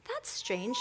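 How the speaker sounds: lightly voiced girl wondering to herself; a hint of childlike puzzlement; puzzled little girl. A narrator's reading. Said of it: "she thought".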